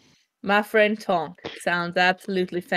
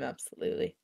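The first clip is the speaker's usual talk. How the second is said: whispered aside; 10 semitones lower, 13 dB quieter than usual. Real, not acted. Quiet aside to the GM.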